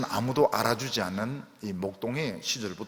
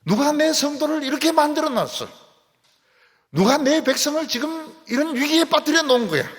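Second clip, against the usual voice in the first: much higher and louder, raging; God's furious roar; 14 semitones higher, 9 dB louder than usual. Acted. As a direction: thunder as God enraged at his people's attackers. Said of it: "하나님이 막 진노를 하시는데". (훨씬 높고 크게 진노하시는 하나님의 고함)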